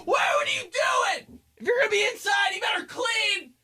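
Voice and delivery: high shrieking mom voice; mom yelling. Retold or imitated. Mom scolding her son for staying inside, high shriek. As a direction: screech it like a fed-up mother. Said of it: "she'd be like".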